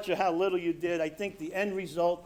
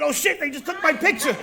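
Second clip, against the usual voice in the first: loud, high-pitched alarmed outburst; comic panic; 9 semitones higher, 5 dB louder than usual. Retold or imitated. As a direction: blurt it out in hammed-up paranoid panic. Acts out paranoid panic at lightning.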